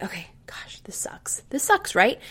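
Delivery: hushed, breathy groan of frustration; a defeated whisper under her breath. Retold or imitated. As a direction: whisper it to yourself in dismay. Venting frustration to herself, quietly.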